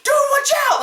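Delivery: high, hammed-up panic; alarmed outburst. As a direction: yell a frantic warning, acted. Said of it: "I would be like".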